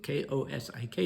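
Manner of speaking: K O S I K spelled letter by letter. Shown as a demonstration. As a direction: spell the name K O S I K out one letter at a time. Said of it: "Ko-, Kozik".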